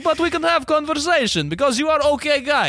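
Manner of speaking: high, solemn mock Russian accent; earnest Russian man confronting a Brit; high Russian accent, serious.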